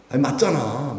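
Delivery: usual speaking voice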